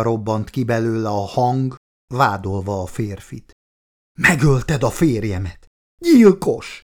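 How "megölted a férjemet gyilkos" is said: accusation building to a high, loud cry; screaming accusation. A narrator's reading. Woman accusing her lover of killing her husband, screamed. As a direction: burst out, pitch leaping on the last word.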